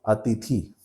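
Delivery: अतिथि with a long final ई; common mispronunciation. Shown as a demonstration. Teacher showing how everyone says it, mispronunciation demo. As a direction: say अतिथि as people do, ending in long ee. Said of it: "लेकिन ये दीर्घ ई हो जाता है".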